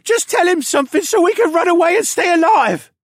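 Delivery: high, shouted, whining plea; frantic sniveling captive; desperate pleading. A narrator's reading. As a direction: beg him at the top of your voice. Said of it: "the first one pleaded".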